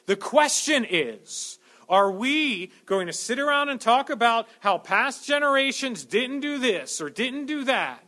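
loud, rising sermon delivery; fervent preaching. Acted. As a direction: preach it with building fire. Preaching at full force.

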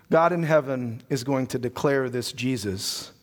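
ordinary talk

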